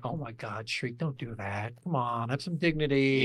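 soft, low, mildly sad plea; sad pleading. Retold or imitated. Retelling a sad game moment, soft mock pleading. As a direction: plead softly, as if saddened by it. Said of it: "where I got so sad".

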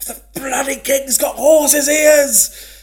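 drawn-out high, loud scream; a stretched, hammed-up scream into the mud. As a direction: bellow it slowly at the top of the voice. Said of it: "and he screamed".

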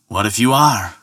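mildly defiant comeback; retort. Acted. Ferryman answering Thor's suspicion, mildly retorting. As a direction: throw the question straight back. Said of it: "retorted the ferryman".

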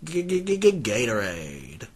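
stuttering G before Gatorade; stuttered buildup. Acted. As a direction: stutter g-g-g then Gatorade slowly.

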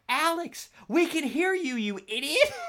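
high, loud jeering; mocking call-out. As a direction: yell it at the fool, laughing. Classmates mocking him on call.

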